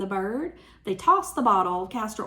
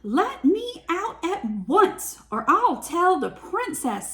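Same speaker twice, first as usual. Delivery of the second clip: high, slow mock-outraged lady; hammed-up bossy lady; indignant demand; 7 semitones higher than usual. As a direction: scold from inside the belly. Swallowed woman demands release.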